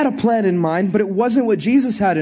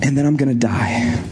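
low whisper of the coming death; Jesus whispering to his disciples; whispered reveal; 8 semitones lower than usual. Acted. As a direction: whisper it like a grave secret.

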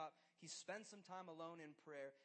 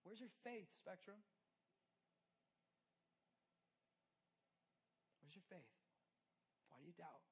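much softer searching questions; soft challenge; 8 dB quieter than usual. Acted. Preacher quietly asking the youth group where their faith is. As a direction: let it drop to a hush.

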